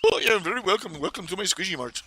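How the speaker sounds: put-on Apu Indian accent. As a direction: ham up a cheerful Indian shop clerk accent. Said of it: "everybody there is Apu".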